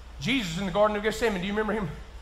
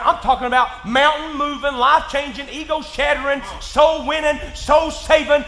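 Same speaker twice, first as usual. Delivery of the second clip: shouted, climbing sermon delivery; full-force preaching; 3 semitones higher, 6 dB louder than usual. Acted. Pastor driving home his point to the congregation, shouting. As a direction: preach it out loud and hard.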